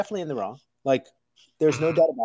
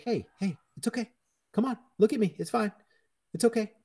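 higher-pitched, gentle reassurance; soothing a child; 5 semitones higher than usual. Acted. Dad calming his six-year-old over a Game Boy, soothing.

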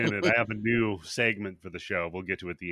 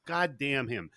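normal talking voice

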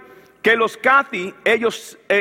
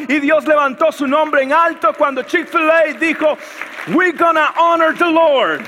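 preaching at full force; 6 semitones higher than usual. Acted. Pastor preaching to his church, proclaiming the vow.

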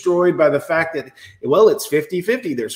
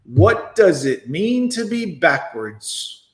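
slow deliberate repeat; much slower than usual. Shown as a demonstration. Replaying her question for viewers, slow and deliberate. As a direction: drag every word out slowly. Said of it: "she's showing us in real time".

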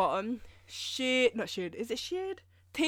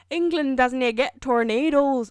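slow, loud, bad Scottish accent, pitched up; butchered Scottish accent; 4 semitones higher, 9 dB louder, slower than usual. Quoting friend's Scottish mum, badly imitated Scottish accent. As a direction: mangle it into a clumsy Scottish accent. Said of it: "it was in a Scottish accent".

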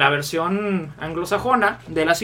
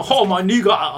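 put-on Sebastian accent parody; 4 dB louder, slower than usual. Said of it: "pero es como".

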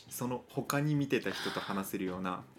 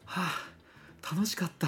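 satisfied sigh; 9 semitones higher, slower than usual. Retold or imitated. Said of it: "って帰ってもらえるような".

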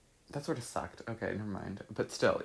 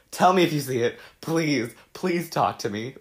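loud pleading, pitched up; mock begging; 5 semitones higher, 7 dB louder than usual. Mocking her younger self begging for comments. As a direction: plead loudly for attention. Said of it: "Me just begging for interaction".

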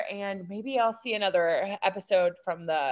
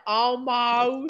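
mock Southern drawl on one word, lifted higher; imitating the baby's Southern accent; Southern belle twang; 5 semitones higher, 3 dB louder than usual. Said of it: "that is a Southern belle".

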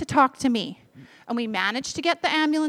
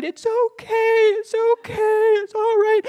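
high, loud tearful comfort; weeping it's okay; crying reassurance; 8 semitones higher, 5 dB louder, slower than usual. Retold or imitated. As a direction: forgive her while crying. And then they both cried.

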